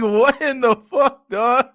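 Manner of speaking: slow, high and loud, laughing; laughing disbelief. Real, not acted. Seeing the corny intro again, laughing disbelief. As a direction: drag it out in stunned laughter.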